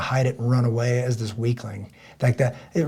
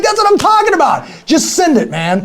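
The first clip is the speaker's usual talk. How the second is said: loud, high, pumped-up yell; hyped shouting; 10 dB louder than usual. Retold or imitated. Telling himself to just go for it, hyped shout. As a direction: fire yourself up like you're ready to go.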